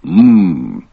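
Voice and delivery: very deep, slow voice opening with a hum; Tonto. Pretend. Answering the serum riddle, deep slow Tonto voice. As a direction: intone it slowly, far below your own pitch. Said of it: "To which Tonto says".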